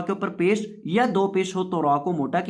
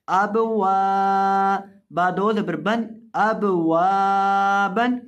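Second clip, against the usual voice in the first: slow, louder chanted syllables; Quranic spelling recitation; 3 dB louder, much slower than usual. Shown as a demonstration. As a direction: build the word syllable by syllable. (ऊँची धीमी तिलावत)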